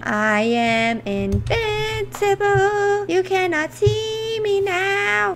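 high, slow, hammed-up proclamation; mock-grand boast. Gamer hiding from creepers, mock-grand and slow. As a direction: declare it slowly like a smug villain.

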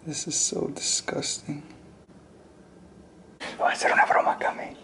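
hushed whisper. Real, not acted. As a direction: whisper the secret plan. Whispered prank plan.

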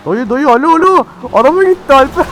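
high loud calls after the car; excited shouting. Real, not acted. Shouting at driver in game.

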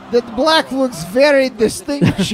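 imitating Igor. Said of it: "Igor's like".

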